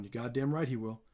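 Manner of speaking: lightly voiced low, quiet mentor; hint of a calm master, dropped low and soft; John Danaher impression. Commentator voicing Master Splinter approving of his fighter, lightly imitated quiet voice. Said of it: "assume I did that accent in his voice".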